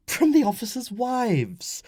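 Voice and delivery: high, slow woman's voice, teasing; amused wife. Acted. Wife reveals her source, amused. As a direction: tease your husband with the answer. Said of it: "Flavia laughed at his expression".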